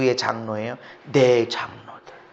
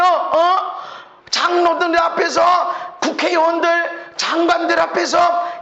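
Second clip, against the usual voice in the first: higher, louder whining for his pride; mock Saul pleading; 8 dB louder than usual. Preacher mocking Saul begging to save face before the people, loud whine. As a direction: beg to save face, indignant.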